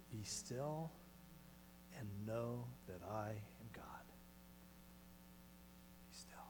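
soft whispered verse; hushed scripture. Acted. Preacher quoting the psalm, soft whisper. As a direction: let the words settle gently.

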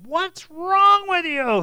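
loud, high, drawn-out scolding; reprimanding. Acted. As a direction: scold them in disbelief, stretching the words. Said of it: "See, he's reprimanding them".

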